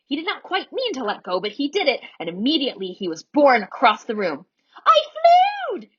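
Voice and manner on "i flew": high, loud shriek of triumph; thrilled scream. A narrator's reading. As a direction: scream it out mid-flight, thrilled. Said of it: "he screamed".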